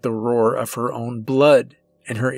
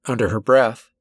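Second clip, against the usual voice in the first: usual speaking voice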